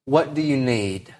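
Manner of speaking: put-on God speaking tenderly to his child; tender father. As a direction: ask it softly like a caring father. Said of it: "And you know what God says?".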